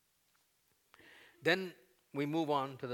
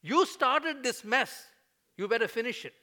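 bold demand; 12 semitones higher, 11 dB louder than usual. Acted. Moses daring God to keep his promise, loud. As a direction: dare God to finish it. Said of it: "He said".

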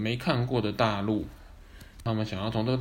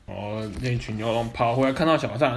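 usual speaking voice

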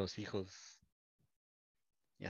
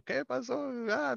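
surprised greeting; 4 dB louder, slower than usual. Retold or imitated.

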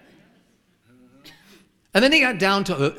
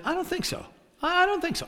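high, sassy "not happening"; a mock-indignant, much higher put-down; defiant refusal. Preacher to himself as the woman leaves, sassy and much higher. Preacher deciding to stop her.

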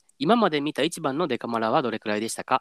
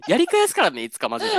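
laughing; 4 dB louder than usual. Real, not acted. Laughing mock threat to listener.

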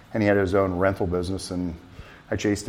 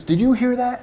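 more excited repeat; 4 dB louder than usual. Retold or imitated. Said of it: "and he goes".

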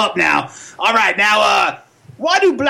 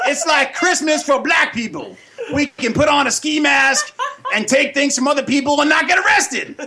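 higher cartoon puppet voice, rowdy and laughing; Pops puppet voice; 9 semitones higher than usual. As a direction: play a brash puppet roasting trick-or-treaters in a higher voice.

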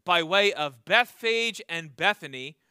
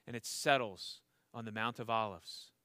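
hushed reveal; 11 semitones lower, 10 dB quieter than usual. Acted. Dramatic hush in sermon.

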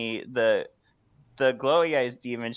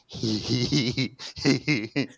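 tags=1; slower than usual. Retold or imitated. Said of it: "somebody off screen go".

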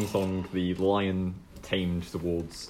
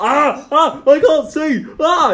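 high, loud pained troll cries; a blinded monster wailing; 11 dB louder, slower than usual. Acted. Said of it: "and it's like".

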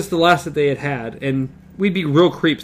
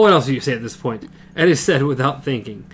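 ordinary delivery, nothing marked

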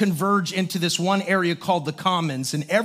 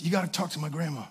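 hushed quote; 4 semitones lower, 10 dB quieter, much faster than usual. Retold or imitated. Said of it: "he goes".

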